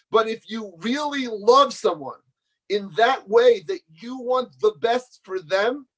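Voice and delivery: ordinary talk, nothing marked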